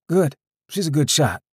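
put-on young man voice. Boy answering father.

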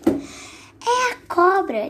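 high, slow little mouse; squeaky mouse voice. Acted. Little mouse tells the gruffalo who is coming, high and squeaky. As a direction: pipe it up like a tiny mouse.

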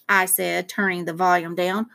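usual speaking voice

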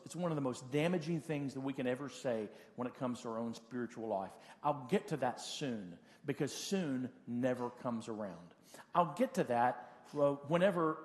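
normal talking voice

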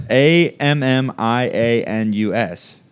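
slow, letter by letter; spelling it out. Real, not acted. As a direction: spell each letter slowly. Spelling name for friend.

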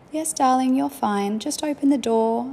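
a little girl imitating a soothing parent; child playing mum. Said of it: "she would be on the other side of it going".

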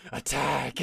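loud battle command; commanding cry. Acted. Creator unleashing his beast, loud command. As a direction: order the beast to charge.

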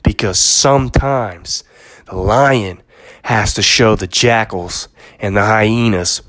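lightly imitating a Christopher Walken movie speech; dramatic retold movie story. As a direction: spin the lion tale like a brooding monologue. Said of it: "This is what he says".

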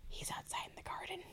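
secretive high whisper. Retold or imitated. Sister tells Aphrodite where Evander waits, in a whisper. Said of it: "went to her like".

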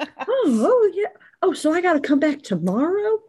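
high, awkward, fumbling reply; flustered character voice. Retold or imitated. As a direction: play caught out and awkward. Acting Imogen's flustered reply.

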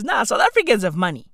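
usual speaking voice

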